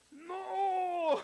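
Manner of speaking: little brother's horrified scream, imitated; anguished shout. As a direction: yell it as the statue shatters. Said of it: "se queda así".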